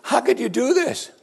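high-pitched alarmed question, acted out; frightened. Frightened men question Jonah aboard ship, high alarmed disbelief. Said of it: "the men became extremely frightened".

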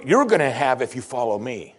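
ordinary delivery

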